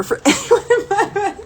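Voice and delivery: high voice breaking into laughter; mock tearful threat. Woman joking about angry tears, laughing mock threat.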